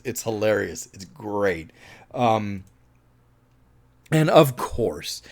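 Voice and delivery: ordinary delivery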